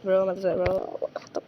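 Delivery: ordinary talk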